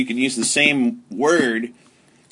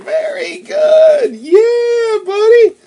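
excited praise; 6 dB louder, much slower than usual. Real, not acted. Parent thrilled at the child's Mandarin. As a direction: cheer him on, squealing high.

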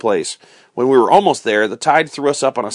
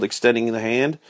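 plain reading voice

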